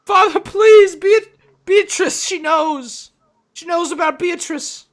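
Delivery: high, loud panicked cry; desperate pleading shout. Acted. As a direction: beg frantically at the door. Desperate man pleading at door.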